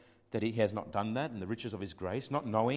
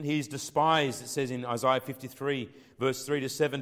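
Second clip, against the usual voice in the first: ordinary talk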